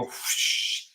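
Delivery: drawn-out, breathy fan whoosh; whooshing fan noise. Retold or imitated. Telling co-host how the laptop fan kicked in, imitated whoosh. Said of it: "turn on and go".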